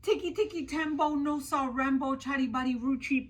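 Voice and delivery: fast, breathless name; rattled off fast. Acted. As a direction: race through the long name.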